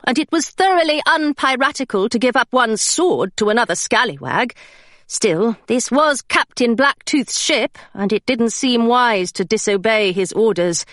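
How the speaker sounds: ordinary delivery, nothing marked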